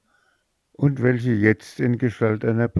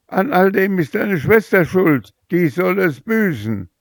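furious blame; 12 semitones higher, 5 dB louder than usual. Acted. Furious mother blames the sister.

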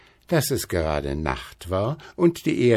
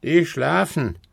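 higher-pitched rooster answer; rufender Hahn; 8 semitones higher than usual. A narrator's reading. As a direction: call it down from the tower. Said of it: "rief der goldene Hahn herunter". (hoch herunterrufender Hahn)

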